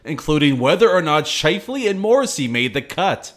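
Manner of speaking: loud, upbeat show teaser; hyped podcast intro. Acted. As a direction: punch it like an opening hook.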